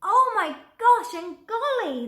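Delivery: high, loud startled exclamation; startled cry. A narrator's reading. Elephants knocked over by Elmer's boo, startled loud cry. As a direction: cry it out, jolted in surprise. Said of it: "they said".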